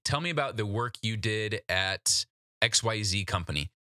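usual speaking voice

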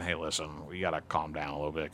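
low, soft talking-down; calming down. Acted. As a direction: quietly take an overheated parent aside. Acts out calming a yelling parent.

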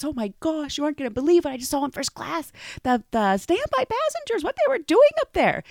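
breathless shocked tattling; scandalized gossip. Retold or imitated. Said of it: "She says".

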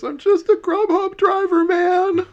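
very high, loud panicked plea; terrified plea. Acted. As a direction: squeal it in panic. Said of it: "and the guy goes".

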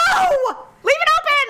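very high, exasperated shriek; retold yell. Wife yelling at her husband about the door, retold high shriek. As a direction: re-enact screaming an order at your husband. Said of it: "diatribe of me yelling at everyone".

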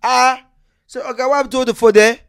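put-on high, loud Nigerian accent; Nigerian officer. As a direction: shout it like the cop.

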